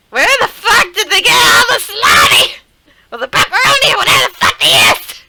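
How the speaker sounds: high, loud yell cracking with laughter; laughing yell. Real, not acted. Streamer laughing-shouting at the salami weapon, high and loud. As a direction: shout it through a laughing fit.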